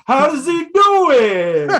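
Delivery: high, loud, drawn-out wail; impressed guys. Retold or imitated. Guys amazed after the slap, high slow shout. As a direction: holler in mock amazement. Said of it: "the guys are just impressed".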